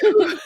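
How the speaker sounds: loud, high relieved whew; mock relief. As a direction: blow out a big relieved whew. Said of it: "I'm like".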